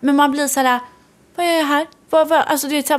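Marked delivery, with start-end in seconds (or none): 1.4-2.4 higher, bewildered asking out loud; a dazed, baffled question; mock bewildered, higher and lost; confused. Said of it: "man blir så här förvirrad".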